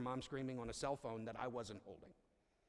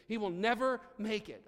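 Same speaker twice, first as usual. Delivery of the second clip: urgent plea; 8 semitones higher, 9 dB louder than usual. Real, not acted. Pleading for a home.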